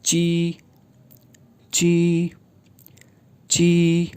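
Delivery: ची, unaspirated; ची like the Mandarin syllable ji; pinyin ji. Shown as a demonstration. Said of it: "तो जे बोला जाता है जी".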